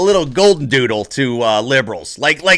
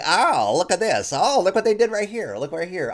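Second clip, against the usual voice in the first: mock nitpicker; 6 dB quieter than usual. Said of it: "I'm looking through it just like".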